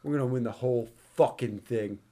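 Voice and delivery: under the breath like a dramatic line; low, quiet movie quote. Retold or imitated. Fan quoting the climax line, low and quiet. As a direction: drop low and deliver it quietly. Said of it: "he stops and goes".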